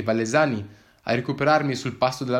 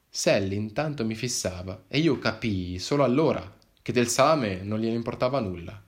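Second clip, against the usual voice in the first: ordinary talk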